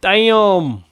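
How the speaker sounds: ordinary talk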